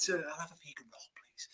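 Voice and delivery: barely audible whisper, hiding the voice; whispered order. Retold or imitated. Away fan ordering at Greggs among rival fans, hushed whisper. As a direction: whisper so nobody hears the accent. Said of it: "Can you speak up, love?".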